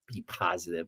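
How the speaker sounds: soft, scornful repeat of be positive; mock the advice under your breath; scoffing mutter. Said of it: "still kinda huffing and puffing".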